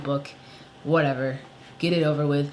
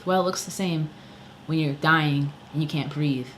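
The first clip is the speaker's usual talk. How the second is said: normal talking voice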